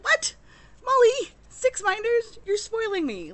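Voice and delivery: squealing high and loud, reliving the shock of the gift; shocked delight. Retold or imitated. Reliving her reaction to Molly's generous gift, loud high shocked delight. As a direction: gasp it out, over the moon at the surprise.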